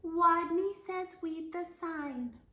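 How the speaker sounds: high squeaky timid voice; high tiny squeak. A narrator's reading. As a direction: squeak it out from inside the jacket. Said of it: "and he squeaked".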